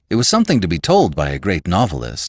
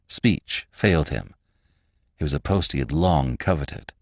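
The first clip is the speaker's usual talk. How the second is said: ordinary delivery, nothing marked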